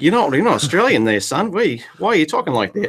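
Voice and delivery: mock Australian dad; fake Aussie accent. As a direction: scold a son in a hammed-up Aussie accent. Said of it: "Put on a fake Aussie accent".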